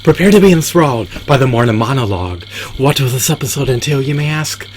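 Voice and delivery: punched opening, high rising rhetorical question; show announcer. Acted. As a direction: announce it grandly, lift "you may ask" high.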